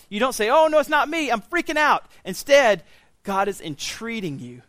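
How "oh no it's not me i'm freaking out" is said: high, loud, panicky voice; mock panic. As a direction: play the frightened believer, hammed-up. Mock panicked believer quote.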